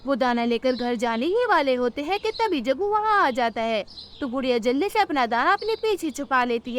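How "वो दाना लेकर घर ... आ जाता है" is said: usual speaking voice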